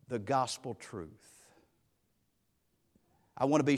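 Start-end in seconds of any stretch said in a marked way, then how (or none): 0.1-1.1 hushed close. Real, not acted. Solemn close of the point.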